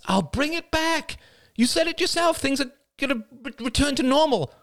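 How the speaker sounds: put-on young woman character voice. Crystal's niece pleading with her aunt, character voice. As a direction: give the character her own bright young voice.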